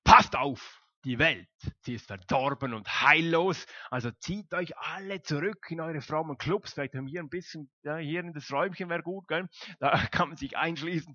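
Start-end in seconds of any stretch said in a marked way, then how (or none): 0.0-6.7 mock alarmist. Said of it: "Und Paulus sagt nicht".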